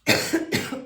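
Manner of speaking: short sharp dry cough; one dry cough, then an apology. Real, not acted. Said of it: "je tousse là".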